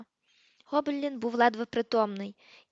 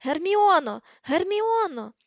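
high, loud cry for someone out of reach; bellowing; 8 semitones higher, 8 dB louder, slower than usual. A narrator's reading. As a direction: yell the name out in desperation. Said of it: "перестань кричати".

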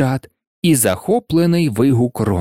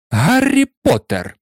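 high, stern exclamation; scandalised teacher; 9 semitones higher than usual. A narrator's reading. Professor catching Harry flying, scandalised teacher. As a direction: call the name out in high outrage.

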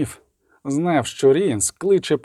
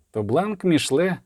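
plain reading voice

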